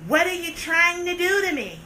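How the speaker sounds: ordinary delivery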